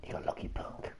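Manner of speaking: hushed, breathy menace; whispered taunt. Acted. Gamer whispering a taunt at his lucky opponent. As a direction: hiss it under your breath.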